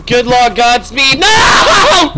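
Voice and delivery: a loud high cry as he gets caught; desperate shout. Real, not acted. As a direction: yell out as the monster grabs you. Dying in game, shouting.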